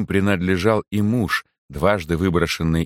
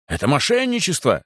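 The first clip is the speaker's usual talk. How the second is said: higher-pitched outcry, only mildly raised; indignant cry; 7 semitones higher than usual. A narrator's reading. Stubborn guest accuses the chaplain, indignant cry. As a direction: protest with a contained shout. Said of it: "закричал упрямый гость".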